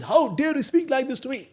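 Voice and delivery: indignant retort. Retold or imitated. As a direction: throw the same outrage back at the cop. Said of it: "came down from his bike and he said".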